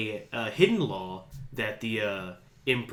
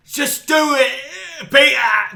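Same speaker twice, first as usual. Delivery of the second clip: high, loud mock yell of urgency; urgent shout; 13 semitones higher, 10 dB louder, slower than usual. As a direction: yell it at Peter to hurry. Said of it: "And it's like".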